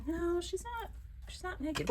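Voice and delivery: high, soft, small-voiced denial; tiny high-pitched defence; meek protest. Meek reply to scandalized granny.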